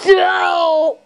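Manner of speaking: drawn-out yelled no; dramatic cry of no. Acted. Victim in a gory skit attack, drawn-out yell. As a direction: yell a long, falling no.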